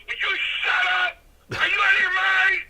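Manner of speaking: a scolding yell as Strongbow; hammed-up angry yell; angry shout. Strongbow yanking him, scolding.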